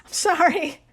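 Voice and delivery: high, loud sorry on a laugh; laughing apology. Real, not acted. Podcaster dismissing alien theories to listeners, laughing apology.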